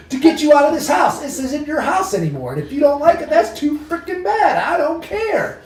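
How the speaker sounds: high-pitched, fed-up ultimatum; retold stern telling-off. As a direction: lay down the law to the ghosts. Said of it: "I had to come into Jesus with the ghosts".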